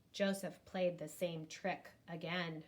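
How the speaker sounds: ordinary delivery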